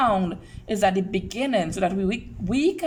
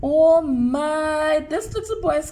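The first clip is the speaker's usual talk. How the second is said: mock surprise; 5 semitones higher, 5 dB louder than usual. Teacher reacting to a picture for children, mock surprised. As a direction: exclaim it in playful surprise, stretched out.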